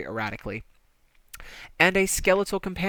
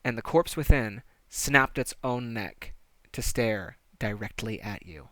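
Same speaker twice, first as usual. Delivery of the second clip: normal talking voice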